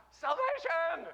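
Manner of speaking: high, loud burst of joy; acted jubilant outburst; exultant cry. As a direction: shout it out like a celebration. Voices how believers should rejoice.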